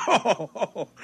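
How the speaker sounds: chuckling at a dreamer; amused laugh. Acted. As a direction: laugh at him, half fond. Amused by George's nuclear rocket.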